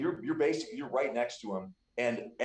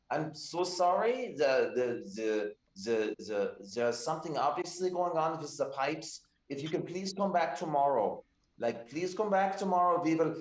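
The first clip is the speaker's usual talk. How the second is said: flustered, higher-pitched bath attendant pleading politely; a nervous hostess begging guests to leave; apologetic attendant; 4 semitones higher than usual. Acted. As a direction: GM voicing a flustered bath keeper apologizing to the party. GM voices Greta, apologetic bath attendant.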